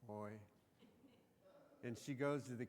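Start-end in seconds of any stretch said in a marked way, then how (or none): 0.1-0.3 exasperated Yiddish mother's "Oi"; a long-suffering mother's groan; mock Yiddish "Oi". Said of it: "Did you catch that?".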